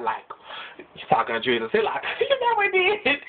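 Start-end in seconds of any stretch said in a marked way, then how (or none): 2.2-3.3 squeaky question breaking into laughter; laughing high squeal. Acted. God asking Jesus, then bursting out laughing. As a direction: crack up at it.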